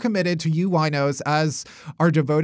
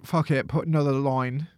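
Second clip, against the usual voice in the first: put-on posh British accent; 4 semitones lower, 4 dB quieter than usual. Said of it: "That's how the Queen talks".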